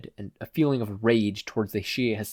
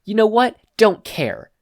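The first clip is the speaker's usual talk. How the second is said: high, loud sneering thug voice; 6 semitones higher, 10 dB louder, slower than usual. Acted. Thug shrugging off his boss, loud and sneering. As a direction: brush him off like a cocky thug.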